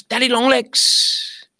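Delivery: high, drawn-out mock shout; imitated shout. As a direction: call it out high and slow, imitating an excited cry. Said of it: "and he shouts".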